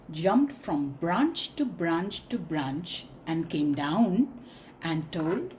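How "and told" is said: usual speaking voice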